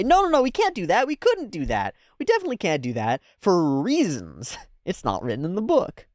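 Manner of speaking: mock refusal. Reactor voicing the mage refusing, hurried mock. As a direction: rush the denial like a caught bluffer.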